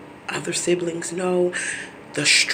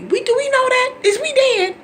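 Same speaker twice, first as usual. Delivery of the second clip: very high, loud disbelieving squeal; high, loud, disbelieving "Is we dead?"; incredulous mock question; 4 dB louder than usual. Real, not acted. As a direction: shriek it in disbelief.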